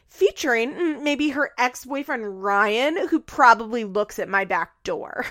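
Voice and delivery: hammed-up scornful impression, stretching words; mock indignant rant. Acted mocking rant as Farrah.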